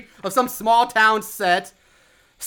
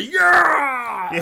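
mock deep, throaty victory shout; victory yell; 2 semitones higher than usual. Stephanie just killed Rich Tits, victory yell. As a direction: roar it out in mock triumph. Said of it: "lets out a deep victory yell".

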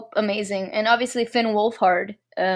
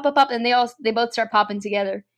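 ordinary talk

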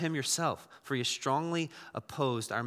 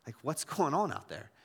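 puzzled retort; 7 dB quieter than usual. Retold or imitated. Pastor answering the coffee shop guy, puzzled. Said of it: "I said".

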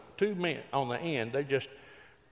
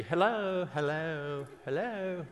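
perky hello; 2 semitones higher, 4 dB quieter, slower than usual. Retold or imitated. Preacher teasing ladies about phone greetings, perky.

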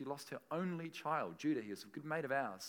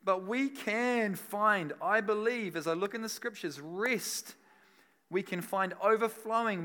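full-force preaching; 2 semitones higher, 8 dB louder than usual. Acted. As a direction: preach it out to the whole room.

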